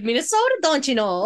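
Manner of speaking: high, lilting mock Minnesota accent. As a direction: play up the Minnesota accent with a laugh. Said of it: "but in that accent".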